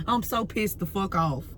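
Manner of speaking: ordinary delivery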